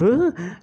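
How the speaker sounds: quick high nervous giggle; nervous laugh. Shown as a demonstration. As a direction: demo a jittery nervous laugh. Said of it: "nervous laughters".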